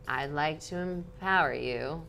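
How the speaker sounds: slow, drugged drawl on muscle relaxers; imitating her own slowest-ever speech; slowest ever speaking. To an audience while on muscle relaxers, slow drawl. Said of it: "the slowest I've ever spoken".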